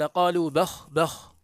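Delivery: بخ said with a clipped, unvowelled final خ; teacher demonstrating بخ ending on a still خ; bakh with silent kha. Shown as a demonstration. Teacher to students, showing بخ with silent kha. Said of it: "هكذا بخاء ساكنة".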